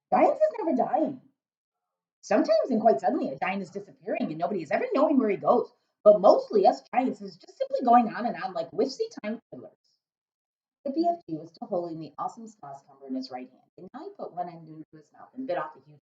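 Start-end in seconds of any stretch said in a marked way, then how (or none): 0.1-9.7 loud, booming friendly giant; a big-hearted giant rumbling away. Acted. BFG speaking.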